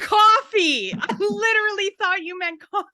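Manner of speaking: high, loud, half-crying disbelief; shocked disbelief. Retold or imitated. Woman holding mugs, squealing at her naked date. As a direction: squeal it out in horror. Realizing what he meant.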